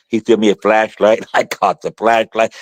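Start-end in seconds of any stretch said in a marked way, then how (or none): none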